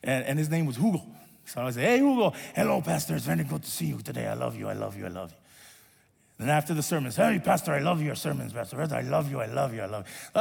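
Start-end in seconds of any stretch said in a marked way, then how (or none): 1.8-5.4 Hugo's voice. Retold or imitated. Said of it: "his voice is like this". 7.2-10.4 Hugo's voice. Retold or imitated. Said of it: "his voice is like this".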